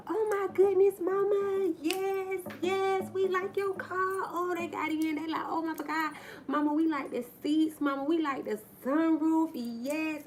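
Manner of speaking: put-on very high, squealing children's voices; small children thrilled with mama's new car; excited little kids.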